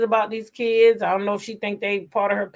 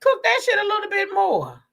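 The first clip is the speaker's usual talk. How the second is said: high excited; 13 semitones higher than usual. Real, not acted. Excited rant to chat.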